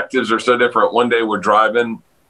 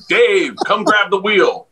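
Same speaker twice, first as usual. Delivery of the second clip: higher, slow, offhand request; casual bus driver; 8 semitones higher, slower than usual. Retold or imitated. Driver asking Dave to take over driving, offhand. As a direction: imitate a driver calmly handing off the wheel. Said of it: "and he goes".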